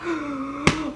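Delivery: sharp, breathy gasp of alarm; quick, hammed-up startled gasp; mock gasp. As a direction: gasp as if finding something awful. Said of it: "and he's like".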